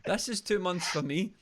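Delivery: slow, laughing through the words. Real, not acted. Host laughs comparing his own two-month beard, laughing. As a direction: giggle it out in disbelief.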